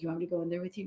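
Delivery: a hushed doubtful ask; quiet incredulous quote. Retold or imitated. Nurse asked to join a tense room, quiet doubt. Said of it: "some of them just be like".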